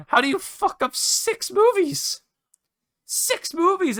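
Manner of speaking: very high squeaky incredulous cry; real high-pitched, drawn-out disbelief; exasperated disbelief. Real, not acted. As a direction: voice shoots way up in exasperation. Exasperated rant about ruined films.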